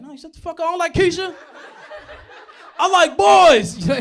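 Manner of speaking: high, loud, laughing protest; flustered kid denial. Retold or imitated. Comic voicing his younger self denying a crush, high and loud. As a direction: blurt it out like a caught kid.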